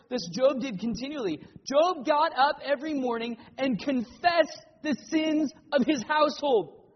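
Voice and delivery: loud, driving sermon voice; forceful preaching. Acted. Pastor urging the congregation with Job's example, forceful preaching. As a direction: preach it out to the whole room.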